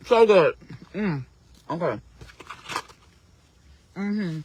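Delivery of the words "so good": talking through a bite; mouth full. Real, not acted. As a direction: savor it with food in mouth. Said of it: "One bite".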